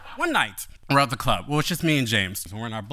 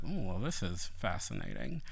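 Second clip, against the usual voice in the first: much softer, deadpan sarcastic aside; dry aside; 5 semitones lower, 11 dB quieter than usual. Retold or imitated. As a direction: murmur it with dry irony. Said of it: "And I was like".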